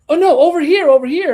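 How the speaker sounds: very high, loud mock panic; hammed-up urgent cry; frantic client. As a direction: imitate a frantic customer redirecting someone. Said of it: "she opens the door all frantic".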